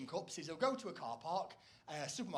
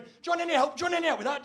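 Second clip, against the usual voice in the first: much higher and louder, eager; pushy teenage shop assistant; 10 semitones higher, 11 dB louder, much faster than usual. Retold or imitated. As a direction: pester the customer like an overkeen teen clerk. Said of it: "spotty teenager will come running over going".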